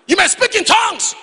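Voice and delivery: high, loud challenge; preaching shout. Real, not acted. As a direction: throw it out to the congregation.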